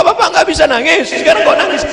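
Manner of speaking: high, loud acted challenge; mock-accusing cry of surprise; astonished questioning. Questions the weeping pilgrim.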